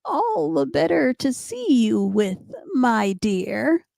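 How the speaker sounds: high, put-on sweet disguise voice; high sing-song storybook wolf; wolf posing as grandmother. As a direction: coax like a wolf playing granny. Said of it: "the wolf replied".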